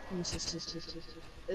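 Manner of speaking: echoing like inside a cave. Real, not acted. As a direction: voice bouncing in a big cave. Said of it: "You sound like you're in a cave".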